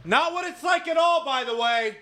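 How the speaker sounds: high-pitched indignant holler; mock angry yell. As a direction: shout a complaint across the set. Continues voicing the yelling cop.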